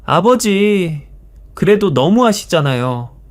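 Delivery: high, slow, drawn-out cry of 아버지; loud protest. A narrator's reading. Son suddenly raising his voice at his father, loud and high. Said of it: "큰 소리로 말했습니다".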